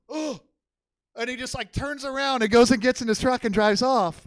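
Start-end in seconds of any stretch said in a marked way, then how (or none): 0.1-0.4 pained grunt. Retold or imitated. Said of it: "he goes".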